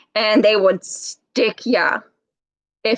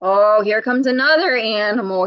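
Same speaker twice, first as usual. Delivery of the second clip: plain reading voice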